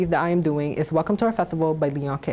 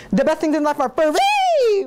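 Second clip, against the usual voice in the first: hammed-up boast; 7 semitones higher, 4 dB louder than usual. Host boasting to audience.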